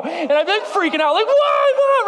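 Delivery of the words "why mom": high, loud wail of betrayal; mock-anguished child's shriek; despairing kid. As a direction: cry out to mom in a panic. Dream kid betrayed by mom.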